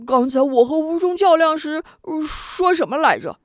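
high, naive hulking fellow asking; a dim giant wondering aloud; simple big brute. A narrator's reading. Long Biao voiced, loud high. (憨傻大汉，声音高而天真)

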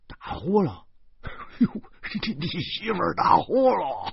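high, incredulous exclamation with a laugh; can't believe what you just heard; 惊讶地追问; surprised. Acted. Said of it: "惊讶地说".

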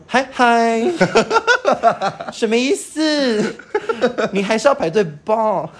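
slow, high, sassy challenge; high mocking voice. Sassy friend challenging the queue jumper. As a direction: drag it out to needle him. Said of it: "二姐就说".

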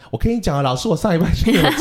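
put-on high, bright chatty child, fast, tears forgotten; eager little girl. Teacher imitating the girl happily switching to talk about her trip. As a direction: play a girl who has instantly stopped crying to chat.

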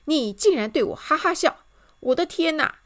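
loud, high bellow; outraged yell. A narrator's reading. King can bear her laughing no longer, yelling. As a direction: roar it in outrage. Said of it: "他大吼".

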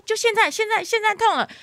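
high, loud strained cry of pain. Retold or imitated. Mother tells the nurse it hurts now, strained and pained. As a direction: cry out through a contraction. Mother quoting herself in labor.

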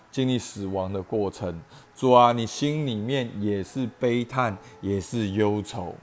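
earnest spoken prayer. Real, not acted. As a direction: pray aloud for the listeners, earnestly. Leads congregation in prayer. (恳切祷告)

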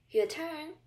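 usual speaking voice

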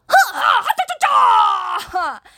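big proud hero laugh, shouted high; booming braggart's ha-ha-ha; hearty boastful laugh. Acted. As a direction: laugh out loud like a cocky warrior.

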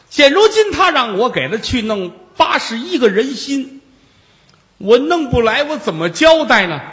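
high, loud, pleading Taoist voice; frantic Taoist. Acted. Taoist begging Jigong for help against the demon, high frantic pleading.

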